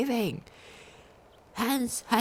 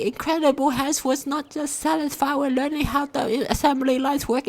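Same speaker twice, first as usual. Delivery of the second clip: ordinary delivery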